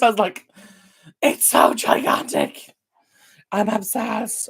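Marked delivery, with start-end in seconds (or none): 1.2-4.5 awed breathy tourist whisper; starstruck tourist. Retold or imitated. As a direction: gush like a wide-eyed tourist. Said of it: "I was such a tourist".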